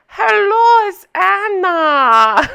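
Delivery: high, drawn-out loud mock accent from Anna's show. Recapper greeting viewers as the show's heroine, exaggerated accent. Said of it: "this accent on this girl".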